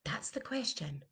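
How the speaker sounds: breathy whispered aside. Real, not acted. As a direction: drop to a whisper to make it sink in. Coach pauses, whispers the point.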